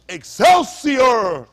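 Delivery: slow, loud proclamation of Excelsior; drawn-out shout. Acted. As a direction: stretch Excelsior out like a grand announcement. Proclaiming the place name.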